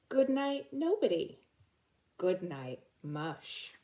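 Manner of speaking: slow, gentle bedtime story read; soothing picture book reading; reading to children. Acted.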